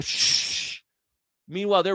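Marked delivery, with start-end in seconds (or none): 0.0-0.8 mock shush. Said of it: "You go in".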